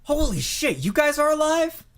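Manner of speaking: high, loud cry of disbelief; astonished guard. Acted. Guard finds the captives living, astonished. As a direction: burst in, stunned they survived.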